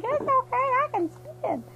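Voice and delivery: put-on silly girlfriend reassuring him; high girlfriend voice. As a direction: squeak it like a cartoon girl.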